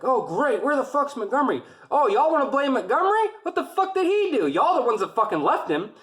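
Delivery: high, loud sarcastic chewing-out; angry grilling. Retold or imitated. Drill sergeant scolding recruits, high sarcastic yell. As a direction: tear into them for leaving him. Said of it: "grilling them".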